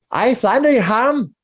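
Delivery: chirpy tavern landlady voice; bubbly barmaid. Acted. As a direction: greet the bard like a delighted hostess.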